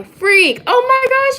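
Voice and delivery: sudden jump way up in pitch; real alarm as the phone dies; startled exclamation. Real, not acted. Phone about to die mid-live.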